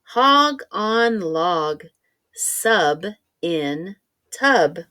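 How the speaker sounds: each phrase slow and stretched; slow careful reading. Shown as a demonstration. Teacher reading the answer key slowly so kids can check. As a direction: read out the answers slowly and clearly. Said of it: "your work should look like this".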